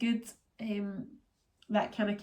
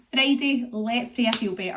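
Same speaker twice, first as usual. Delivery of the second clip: hopeful emphasis; 4 semitones higher, 8 dB louder than usual. Real, not acted. Hopeful plea to viewers.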